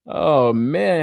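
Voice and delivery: normal talking voice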